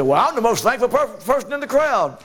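high, mocking voice of a self-satisfied believer; smug boaster. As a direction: lampoon the proud churchgoer.

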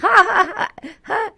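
high, loud cackling giggle; Pee Wee Herman laugh. Shown as a demonstration. DJ shows Finster women can do impressions, high Pee Wee giggle. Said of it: "women can't do impersonations".

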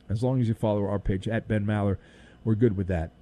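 host quietly restating the plug; soft aside. Acted. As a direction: murmur it like a sly afterthought. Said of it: "repeat said much softer".

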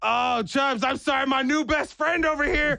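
high, gushing new-best-friend voice; imitated chummy excitement. Father-in-law high-fiving his new friend, high chummy voice.

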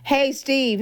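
ordinary talk

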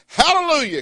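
high, ringing praise cry; ringing hallelujah. Acted. Preacher voicing heaven's crowd, high ringing hallelujah.

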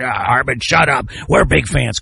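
low grumbling imitation of an annoyed fan; mock heckler. Host mimicking Ram fans answering him, mock annoyance.

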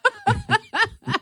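sudden high burst of laughter. Real, not acted. Laughing at a joke.

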